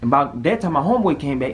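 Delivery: ordinary talk, nothing marked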